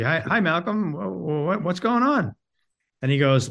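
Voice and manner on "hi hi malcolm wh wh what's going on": lightly voiced sheepish stammer, higher; a mild, nervous retold greeting. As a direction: stammer a timid hello to the star. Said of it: "very sheepishly".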